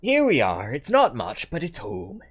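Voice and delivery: Bristolian country granny, welcoming; West Country grandma. Acted. Grandma showing lads into her home, West Country welcome. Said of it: "It's West Country".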